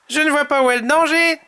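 high, quick smiling character voice; cheerful Tom. A narrator's reading. Tom brushes off the danger, high grinning voice. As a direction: say it fast and high with a grin. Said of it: "dit Tom avec un grand sourire".

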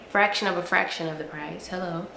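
usual speaking voice

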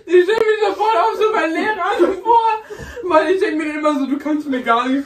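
high, loud laughing speech. Real, not acted. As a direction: talk while cracking up. Laughing while telling.

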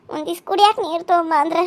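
ordinary talk, nothing marked

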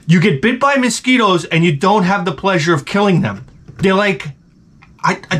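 ordinary talk, nothing marked

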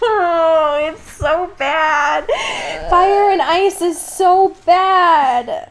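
slow, high, laughing groan of disbelief; laughing despair. Real, not acted. Co-host laughing despairingly about how bad the chapter is.

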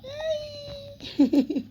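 high giggle. Real, not acted. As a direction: giggle at the kitten. Laughs at cat. (tiz kıkırdama)

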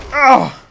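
short, high, loud yelp; startled cry. Real, not acted. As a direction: yelp out in alarm. Attacked in the game.

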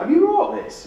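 very high, loud imitated nan; impressed nana. Nana asking about the grandchild's book, thrilled. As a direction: ask in a squeal of mock awe. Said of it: "she's like".

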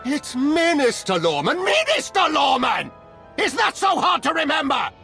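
high-pitched, indignant insistence on his title; exasperated outburst. Acted. Minister correcting people who misuse his title, exasperated outburst. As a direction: blow up at being misnamed, pitch soaring.

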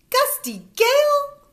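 very high, loud, slow delighted surprise; blurted out. A narrator's reading. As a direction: blurt it out amazed. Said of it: "I blurt out".

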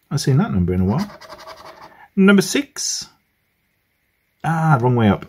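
ordinary talk, nothing marked

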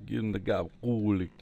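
put-on light Italian accent on "gabagool", falling tune. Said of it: "Enough of my bad Italian accent".

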